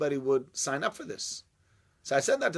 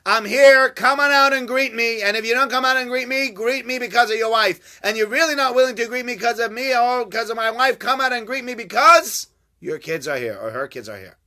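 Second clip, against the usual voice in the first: high, loud demand to come out; insistent calling out; 8 semitones higher, 10 dB louder than usual. Retold or imitated. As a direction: call him out with urgent insistence. Voicing Yitzhak's demand, calling out.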